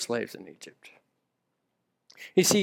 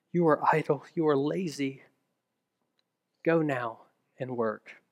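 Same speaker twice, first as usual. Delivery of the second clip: quiet accusation; 2 semitones lower, 7 dB quieter than usual. Retold or imitated. Inner accusing voice, shame.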